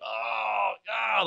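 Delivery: very high, loud imitated ow-oh cries; mock pained groans. Sore friend moans at every step, mock loud cries.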